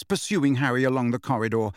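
usual speaking voice

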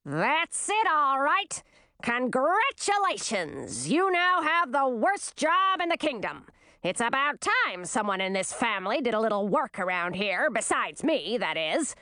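crowing, sarcastic queen; domineering queen mother. A narrator's reading. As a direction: congratulate with gloating scorn.

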